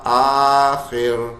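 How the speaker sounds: آخر with a thin, light ر, drawn out slowly. Shown as a demonstration. Teacher demonstrating a thin ر in آخر, slowly. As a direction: stretch آخر and keep its ر thin. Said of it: "تو باریک ہوگا".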